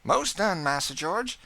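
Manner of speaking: high, bright cook's voice; proud old cook; Aunt Chloe voice. A narrator's reading. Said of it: "said Aunt Chloe".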